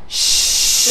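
long whispered shh; gentle breathy hush; soft shush. Shown as a demonstration. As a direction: demonstrate shushing a baby. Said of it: "all you do is this".

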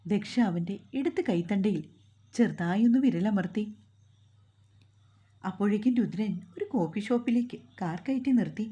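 usual speaking voice

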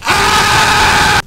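exasperated wailing shout; frustrated shouting. Real, not acted. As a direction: scream at the broken car. Car refuses to accelerate.

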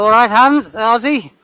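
high, loud greeting in a neighbour's voice; a loosely imitated cheery neighbour; imitating Mrs Digwell. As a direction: chirp it out like the neighbour lady calling over. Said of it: "when Missus, uh, Digwell's is".

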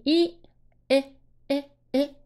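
short "i" sound in separate, quick bursts; short vowel. Shown as a demonstration. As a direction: clip each demonstrated "E，A，A，A" short and apart. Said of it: "这个发短音". (逐个短促地示范短元音“i”)

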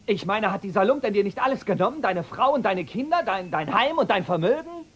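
angry outburst. Acted. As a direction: hurl the accusations at him, building. Angry son confronts his father. (wütend und schnell vorgeworfen)

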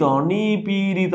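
নিপীড়িত stretched slowly, syllable by syllable; drawn-out syllables. Shown as a demonstration. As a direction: spell out নিপীড়িত by lengthening its vowels. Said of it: "মাঝখানেরটা কি হবে".

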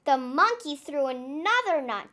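ordinary delivery, nothing marked

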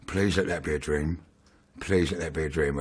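hushed, pleading inner thought; quiet pleading. Retold or imitated. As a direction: murmur it, praying it isn't real. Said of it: "I was thinking".

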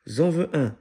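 put-on high, loud, eager little-boy plea; eager child. A narrator's reading. Small boy wanting one of the ducklings, eager and loud. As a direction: pipe up loudly like a keen small child.

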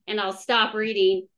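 usual speaking voice

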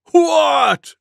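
loud, high bellow. A narrator's reading. Wild Buffalo shocked by Elephant's news, bellowing. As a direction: bellow it in disbelief. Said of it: "bellowed Wild Buffalo".

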